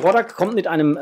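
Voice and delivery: normal talking voice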